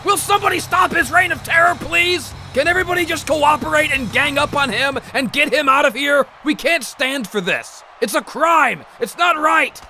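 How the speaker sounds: high, pleading outrage; desperate outraged plea. Acted. Commentator begging wrestlers to stop Pig Swine, high and outraged. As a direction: beg the wrestlers to gang up on him.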